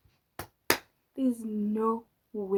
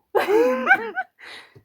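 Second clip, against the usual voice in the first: high burst of laughter; loud laughter; 14 dB louder than usual. Real, not acted. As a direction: cackle out loud.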